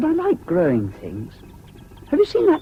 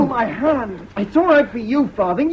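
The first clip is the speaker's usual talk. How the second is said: pained complaint; 8 semitones higher than usual. Acted. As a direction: complain through the pain. Hurt boy complains.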